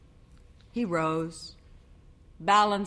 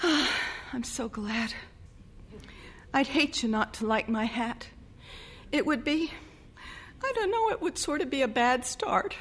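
relieved bride; 7 semitones higher, 3 dB quieter than usual. A narrator's reading.

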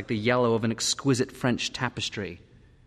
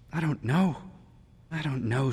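tearful; 3 semitones higher than usual. A narrator's reading. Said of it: "blowing her nose".

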